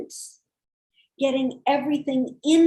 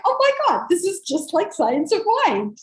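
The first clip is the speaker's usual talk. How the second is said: excited; 6 semitones higher, 4 dB louder than usual. Retold or imitated. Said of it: "I'm like".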